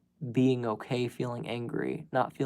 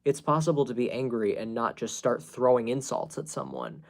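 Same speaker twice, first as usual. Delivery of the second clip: ordinary talk, nothing marked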